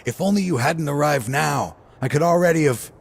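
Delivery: bitter, rising frustration; frustrated. Acted. Man blames Cyno for arriving too soon, frustrated.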